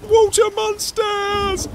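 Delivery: very high, slow shriek; squealed storybook panic; terrified cry. A narrator's reading. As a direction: scream it out in alarm. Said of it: "And one cried".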